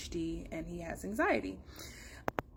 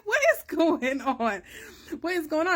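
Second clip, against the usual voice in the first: exasperated disbelief; 8 semitones higher, 5 dB louder than usual. Retold or imitated. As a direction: throw up your hands in disbelief. Mom baffled about no food.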